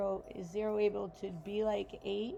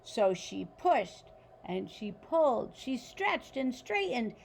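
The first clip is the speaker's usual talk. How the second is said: put-on reading a picture book aloud to children; story narration; 4 dB louder than usual. A narrator's reading. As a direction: tell it like a bedtime story for kids.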